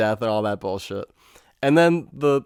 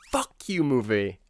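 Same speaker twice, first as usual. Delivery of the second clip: exasperated reaction; 5 dB quieter than usual. Acted. Exasperated reaction to absurd climax.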